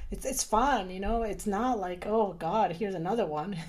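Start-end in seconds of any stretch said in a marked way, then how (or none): 2.1-3.6 weary dread. Retold or imitated. Doctor pretending to dread the next patient, weary. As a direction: sigh it out, fed up.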